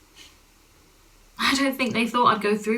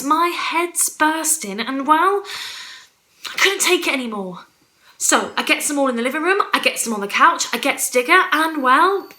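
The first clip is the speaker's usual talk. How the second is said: increasingly loud, higher, strained confession; building to breaking point; 4 semitones higher, 4 dB louder than usual. Acted. Mother confessing what she did to Digger, building in intensity. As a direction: build step by step to the awful deed.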